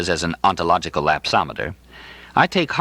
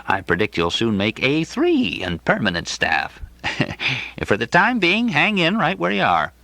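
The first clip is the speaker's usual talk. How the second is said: higher, genial voice of the coughing clinic director; amiable director; 5 semitones higher than usual. Acted. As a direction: play the bulging-eyed director, kindly and a bit vague.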